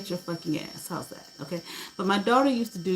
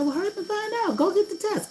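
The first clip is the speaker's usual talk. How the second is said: an imitated impatient boyfriend, pitched much higher; urgent ex. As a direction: hurry her along, mock urgent. Ex urging her, retold.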